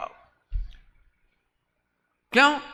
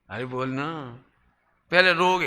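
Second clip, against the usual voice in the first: ordinary talk, nothing marked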